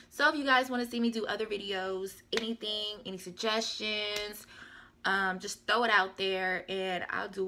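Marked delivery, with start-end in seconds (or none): none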